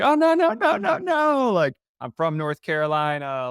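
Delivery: high-pitched rush of denial; hasty protest. Retold or imitated. Reassuring his banker after admitting New York, hasty protest. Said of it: "I forgot my Southern accent".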